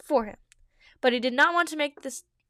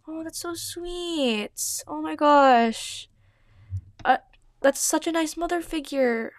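gushing; 4 semitones higher, 4 dB louder, slower than usual. Real, not acted. Gushing over sweet mother.

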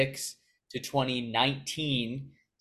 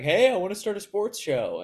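higher-pitched, nasally, awkward younger self; 7 semitones higher than usual. Retold or imitated. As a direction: mimic your own awkward little nasal pitch to a mentor. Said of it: "my nasally little awkward voice".

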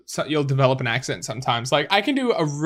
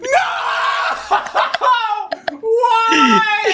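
high, loud wail breaking into laughter; shouted, laughing lament at your own blunder; laughing despair; 3 dB louder than usual. Real, not acted. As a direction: cry out in comic anguish, laughing. Comic despair at own name mistake.

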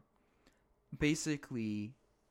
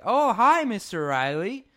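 Eugene's greeting; 8 semitones higher, 9 dB louder, slower than usual. Retold or imitated. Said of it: "he's like".

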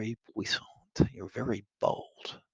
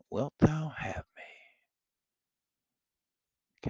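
hushed proposal; 5 semitones higher, much slower than usual. Acted. Henry whispering his proposal to Kate, slow and hushed. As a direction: breathe it close to her ear.

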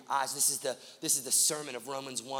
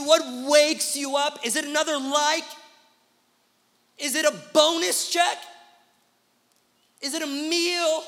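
preaching at full force; 12 semitones higher, 11 dB louder than usual. Acted. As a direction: roar each question at the crowd.

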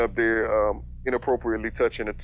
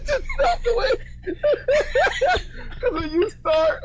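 laughing. Real, not acted. Laughing hard.

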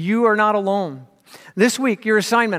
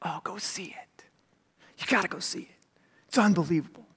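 breathless whispered recommendation; soft awed hush; hushed excited whisper; 2 semitones lower, 8 dB quieter than usual. Retold or imitated. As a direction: whisper it like a secret. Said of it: "You know what I say?".

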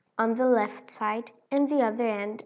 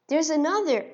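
louder, higher eager cry; excited cry; 5 semitones higher, 7 dB louder than usual. A narrator's reading. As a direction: cry it out greedily. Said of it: "he cried".

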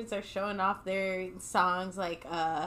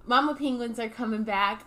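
ordinary talk

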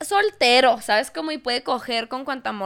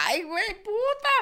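mock hurt; 9 semitones higher, 3 dB quieter than usual. Contrasting insult takes.

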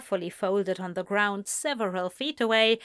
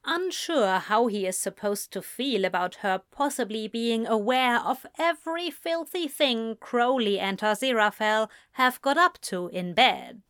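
plain reading voice

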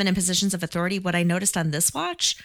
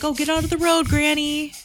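put-on high-pitched heckle at an old lady; high, carefree jeering quote; mock dismissive; 9 semitones higher than usual. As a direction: shoo her off in a high mocking voice. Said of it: "They're just like".

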